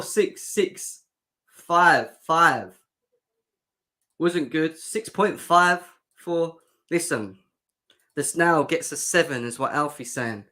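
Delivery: normal talking voice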